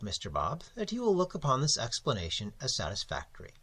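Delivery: smooth over the money talk unctuously; Mister Crabbe. Acted. Editor Crabbe explains payment terms.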